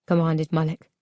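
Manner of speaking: usual speaking voice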